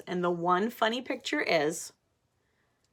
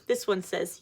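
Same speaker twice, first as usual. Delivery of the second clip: plain reading voice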